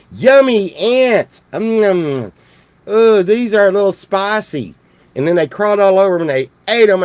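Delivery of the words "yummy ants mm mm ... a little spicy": big, slow, higher-pitched relish; delighted horned toad. Acted. Horned toads tasting fire ants, delighted. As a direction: savor it loudly like a gleeful toad. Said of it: "the horned toads were like".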